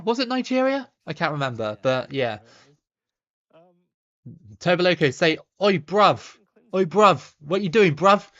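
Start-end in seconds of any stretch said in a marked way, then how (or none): 5.6-8.3 rough London slang, raised up; cheeky London lad calling out; London slang accent. Retold or imitated. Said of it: "That's proper London".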